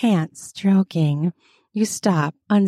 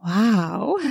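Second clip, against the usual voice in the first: naughty laugh; 3 semitones higher, 3 dB quieter than usual. Acted. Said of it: "grin a naughty grin and say".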